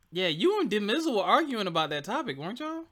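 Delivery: high, playful needling; teasing. Real, not acted. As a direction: stir the pot with a grin. Stirring up the argument.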